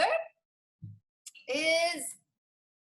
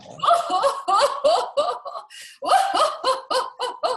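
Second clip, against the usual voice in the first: big loud hearty laugh; loud belly laugh; 9 semitones higher, 8 dB louder than usual. Shown as a demonstration. Showing how everyone laughs, a loud belly laugh. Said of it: "And now all people laugh".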